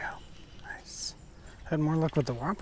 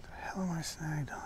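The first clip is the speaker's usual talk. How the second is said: whisper; 9 dB quieter than usual. Real, not acted. Muttering while snagged fishing.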